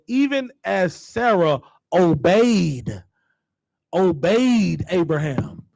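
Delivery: every word drawn out slowly, Even and obeyed leaned on; slow with emphasis. Shown as a demonstration. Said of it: "read it slow and with some emphasis".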